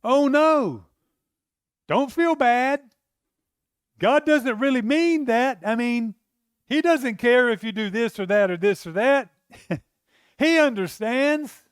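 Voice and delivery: loud, high preaching cry; preaching at full force. Acted. Preacher at full force to the congregation, loud and high. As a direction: drive the point home from the pulpit.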